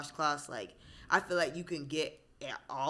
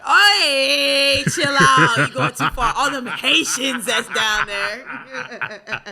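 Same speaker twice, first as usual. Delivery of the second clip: loud, high "chill out" breaking into laughter; laughing protest; 7 semitones higher, 14 dB louder, much slower than usual. Real, not acted. Cutting off her co-host's joke with a loud laughing protest. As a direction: shout him down, half laughing.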